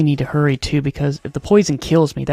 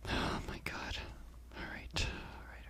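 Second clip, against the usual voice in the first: hushed and scared, barely voiced; tense whisper; 22 dB quieter than usual. Real, not acted. Player creeping through danger, whispering nervously. Tense moment in horror game.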